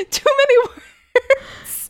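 very high, drawn-out whine; mock wail. Co-host complains about wordy clue, mock wailing. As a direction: stretch it into a comic wail.

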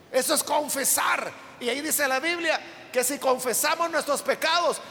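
slow, drawn-out pulpit emphasis; slow preacher's climax; fervent preaching. Acted. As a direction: preach it, stretching each phrase. Preaching the closing point.

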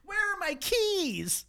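high, slow ditzy whine; mock a scatterbrained woman. Mocking ditzy Caitlin.

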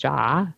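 ja first read with an English j; German yes. Shown as a demonstration. Host explaining the German yes, ja said "jah". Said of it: "the German yes is ja".

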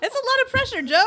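high laughing protest. Real, not acted. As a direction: laugh it out, pleading. Laughing defence of performer.

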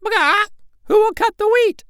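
high, loud brisk hen squawk; brisk hen call. Acted. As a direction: call the yard like a busy hen. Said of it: "calling briskly".

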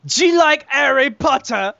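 high, slow, hammed-up British accent; mock British accent. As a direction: put on a loud, drawn-out British accent. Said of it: "you don't need to do the accent".

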